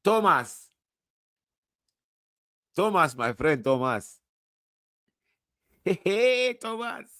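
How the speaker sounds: calling out. Pretend. Calling out to Thomas.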